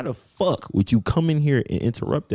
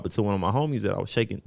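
ordinary delivery

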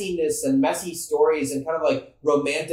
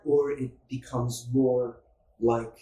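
Edgar Allan Poe voice; 5 semitones lower, 4 dB quieter, slower than usual. Shown as a demonstration. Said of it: "Edgar Allan Poe-ish".

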